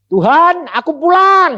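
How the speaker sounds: high, loud, drawn-out call; calling up to God. Retold or imitated. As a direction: call slowly to heaven at full voice. Calls upward to God. (berseru lantang kepada Tuhan)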